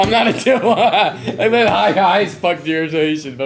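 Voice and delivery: laughing. Real, not acted. Laughing in character.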